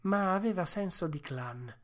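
plain reading voice

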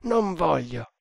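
emphatic, mildly hoarse refusal; hoarse exclamation. A narrator's reading. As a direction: refuse it like a man waking from a nightmare. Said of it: "esclamò con voce rauca".

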